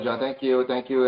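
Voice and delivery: ordinary delivery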